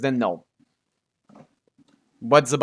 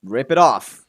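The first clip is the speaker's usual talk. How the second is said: ordinary delivery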